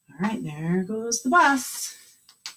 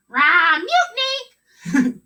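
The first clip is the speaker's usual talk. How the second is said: much higher, shouted parrot cry; parrot squawk; 9 semitones higher, 4 dB louder than usual. Acted. Parrot on the pirate's shoulder squawks mutiny.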